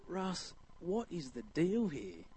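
slow, hushed bewildered question; puzzled appeal. Retold or imitated. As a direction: softly plead for an explanation, drawn out. Puzzled question put to elder.

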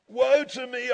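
preacher's mild wail; acted cry of woe. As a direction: cry it out as scripture. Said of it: "I cried".

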